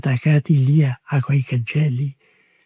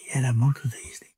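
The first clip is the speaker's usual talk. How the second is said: hushed, sorrowful whisper; whispered sadly; 9 dB quieter than usual. Real, not acted. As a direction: let it fall to a near whisper. Sad memory of the disaster.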